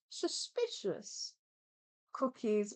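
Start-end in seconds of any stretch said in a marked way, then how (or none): 0.1-1.3 slow, high whispered word. Pretend. Higher whisper repeat.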